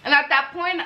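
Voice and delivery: ordinary delivery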